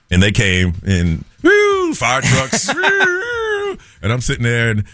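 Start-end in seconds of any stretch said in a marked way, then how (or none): 1.4-1.9 high sustained siren "woo"; imitated siren. Imitates fire trucks arriving. 2.7-3.8 high sustained siren "woo"; imitated siren. Imitates fire trucks arriving.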